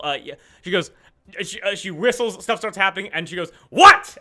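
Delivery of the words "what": sudden loud, high-pitched demand; sharp shouted question. Acted. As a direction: snap it out at a subordinate. Snapping at Olive after whistling.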